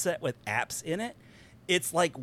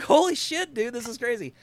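much higher, louder burst of amazement; stunned excitement; 10 semitones higher, 4 dB louder than usual. Retold or imitated. Imitating a viewer blown away by the headset, amazed outburst. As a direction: blurt it like your mind is blown.

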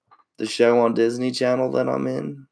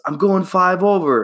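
higher, louder defensive whine; mildly voiced indignant buddy; 8 semitones higher, 4 dB louder than usual. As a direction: protest like a caught bad driver. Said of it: "He goes".

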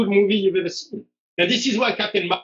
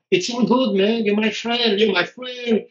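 lightly acted coach, a bit higher; friendly Brazilian coach; 4 semitones higher, slower than usual. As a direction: reassure like a chummy Brazilian coach. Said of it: "'Cause he's Brazilian".

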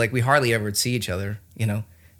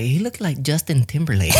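terrible impression of his wife's voice; hammed-up woman's voice, laughing; man doing a woman's voice; 3 semitones higher than usual. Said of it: "it was a terrible impression of my wife's voice".

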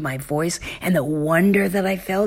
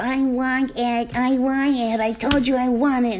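put-on higher, whiny demanding child; sassy child; 7 semitones higher than usual. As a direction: mimic a sassing kid, lightly. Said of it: "they're sassing you".

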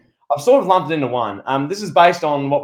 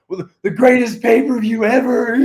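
higher, far-off hammed Triple H brag; Triple H impression; 8 semitones higher than usual. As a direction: puff it up like Triple H. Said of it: "as Triple H does".

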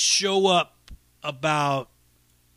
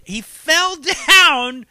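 slow, higher and louder, laughing through it; 9 semitones higher, 9 dB louder, much slower than usual. Real, not acted. Retelling Adam's skateboard fall, laughing. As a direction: crack up while saying he fell.

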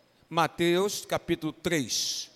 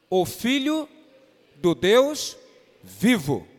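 louder, ringing proclamation, each word spaced out; proclaiming Peter's confession; 8 dB louder, slower than usual. Retold or imitated. Pastor quoting Peter to the church, loud proclamation. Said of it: "Pedro fala assim".

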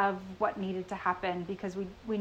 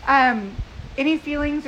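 raised voice against the wind; shouting over wind; 6 semitones higher, 12 dB louder than usual. Real, not acted. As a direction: project loudly over the wind. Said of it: "can you all hear me over the wind?".

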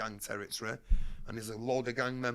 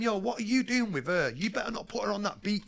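confronting yell; 9 semitones higher, 3 dB louder than usual. Retold or imitated. Confronting the pimp on street.

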